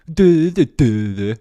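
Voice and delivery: high, loud teasing sing-song; mocking do-do. Streamer mocking a pedant with a loud taunt. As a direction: taunt them with a mock do-do. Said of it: "I do that to people".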